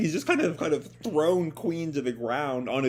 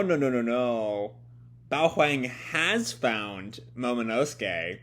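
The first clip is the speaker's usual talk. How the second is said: usual speaking voice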